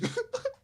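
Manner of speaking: sudden high-pitched laugh, then quiet; high burst of laughter. Real, not acted. Telling friends the robbery story, breaks into a high laugh. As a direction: crack up in disbelief.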